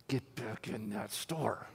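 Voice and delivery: slow, low, hushed stern order; stern command. Retold or imitated. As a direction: give a quiet order that allows no argument. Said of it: "God gives you that stern feeling".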